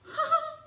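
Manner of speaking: high, delighted gasping laugh; rire émerveillé. Acted. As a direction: let out a thrilled little laugh. Donkey delighted Jesus sits on it. (petit rire aigu et ravi)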